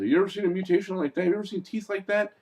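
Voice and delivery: high, fast imitated questioning; quick, high-pitched quoted interrogation; movie character questioning. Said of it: "And the guy said".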